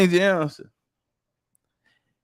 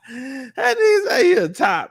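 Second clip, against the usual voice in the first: laughing; 4 semitones higher, 3 dB quieter than usual. Real, not acted. Cracking up at own story.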